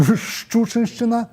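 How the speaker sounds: Шчучыншчына drawn out syllable by syllable; slow tongue twister. Shown as a demonstration. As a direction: savour Шчучыншчына slowly. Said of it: "я іх проста скажу". (Шчучыншчына павольна, па складах)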